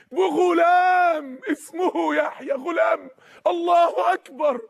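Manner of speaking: high, drawn-out jubilant proclamation; exultant announcement. Acted. Answering Zakaria with news of a son, exultant. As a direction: let the good news ring out slowly and high. Acted joy at promised son.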